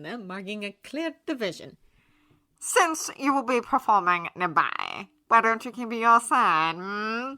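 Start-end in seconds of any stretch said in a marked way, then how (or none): 2.6-7.3 loud, pompous, theatrical aristocrat; haughty gentleman voice. A narrator's reading. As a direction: sneer it grandly like a snob.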